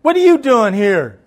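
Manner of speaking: loud, high demand falling at the end; imitated grandma hollering. Grandma challenging an unexpected visitor, hollered imitation. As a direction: holler it through the door.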